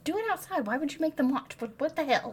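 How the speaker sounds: exasperated inner voice. Retold or imitated. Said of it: "In her head".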